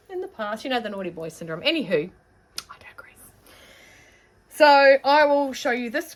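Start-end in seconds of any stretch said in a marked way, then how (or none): 2.7-3.3 whispered aside to herself; voiceless throwaway. Real, not acted. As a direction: breathe it out as a private aside. Trails off after naughty-boy aside.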